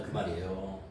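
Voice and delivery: ordinary talk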